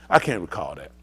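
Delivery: low, lightly voiced Trump dodging the question; a hint of Trump brushing off the court; Trump impression. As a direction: drop the voice low like a slippery witness. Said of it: "And Trump said".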